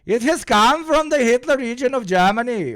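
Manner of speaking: high, declaiming mock-dictator voice; Borat-like Hitler. Streamer joking in his dictator impression, high accented proclamation. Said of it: "Hitler always sounds like Borat".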